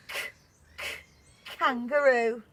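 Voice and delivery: K, k, kangaroo with a crisp k; phonics sound k. Shown as a demonstration. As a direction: say the k sound twice, then kangaroo. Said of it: "the kangaroo sound".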